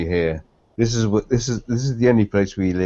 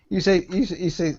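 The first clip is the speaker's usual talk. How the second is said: normal talking voice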